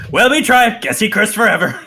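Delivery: high, loud goblin voice. Acted. Goblins admit to each other their plan failed, high goblin voice. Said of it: "They look at each other and go".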